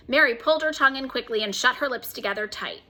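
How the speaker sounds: ordinary delivery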